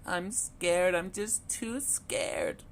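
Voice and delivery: high, slow small voice; the last duck too frightened to jump; tiny frightened voice; scared littlest duckling. A narrator's reading. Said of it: "cried Little Quack".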